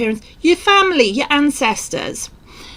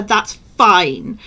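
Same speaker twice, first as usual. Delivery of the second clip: usual speaking voice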